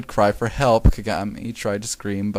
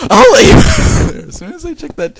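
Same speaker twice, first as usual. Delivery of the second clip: loud high laughing; laughing through words; 12 dB louder, slower than usual. Real, not acted. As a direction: crack up while reading.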